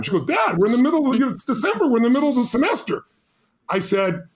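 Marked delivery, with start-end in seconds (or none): 0.2-3.0 higher, quick, mock-whiny protest; lightly voiced daughter pushing back fast; imitating daughter protesting. Retelling his daughter objecting to leaving school mid-semester, higher and quick.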